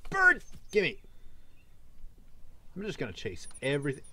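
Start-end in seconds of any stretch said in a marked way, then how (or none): 0.1-1.0 high, loud yelp; excited shout. Real, not acted. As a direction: call it out in a high burst. Spots bird, chases it.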